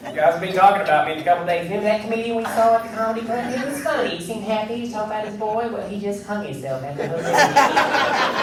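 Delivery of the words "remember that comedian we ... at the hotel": audience gossip. Pretend. Audience members gossiping later.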